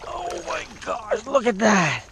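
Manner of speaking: high, loud thrilled exclaim; excited. Real, not acted. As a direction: shout with pure delight at the catch. Sees the huge trout.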